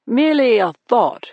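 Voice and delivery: put-on a suave host in a deep British accent. A narrator's reading. Club owner welcoming the cops, in a deep British accent. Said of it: "deep British accent".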